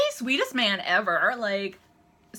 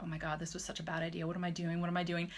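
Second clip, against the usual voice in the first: low-voiced, soft worried self-talk; retold inner panic; 3 semitones lower, 8 dB quieter, faster than usual. Nervous fan doubting herself in line, quietly panicking. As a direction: replay anxious thoughts under your voice.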